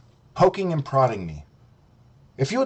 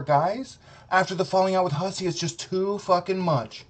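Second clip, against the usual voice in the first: ordinary talk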